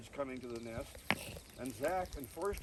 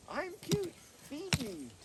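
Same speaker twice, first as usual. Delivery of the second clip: much higher cute nestling voice; begging baby bird; 12 semitones higher, slower than usual. Acted. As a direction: beg like a tiny fledgling.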